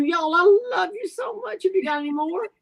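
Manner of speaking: fighting back tears of gratitude; choked up. Real, not acted. Host moved to tears by viewers' comments, tearful.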